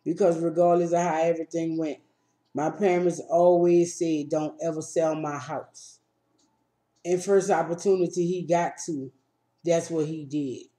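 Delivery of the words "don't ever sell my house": ordinary delivery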